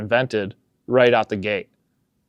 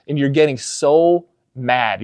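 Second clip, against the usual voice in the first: ordinary talk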